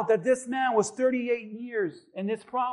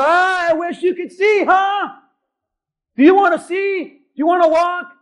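higher and louder, snide teasing; mock insensitive taunt; 6 semitones higher, 8 dB louder than usual. Pastor pretending to taunt a blind man, snide. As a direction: needle him, callous and loud. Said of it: "I go".

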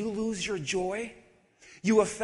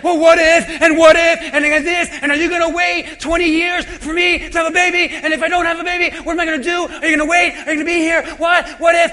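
loud, fast, frantic what-ifs; frantic overthinker; 4 semitones higher, 12 dB louder, faster than usual. Retold or imitated. Congregant panicking out loud over what ifs. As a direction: spiral into panic, rushing. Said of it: "you're like".

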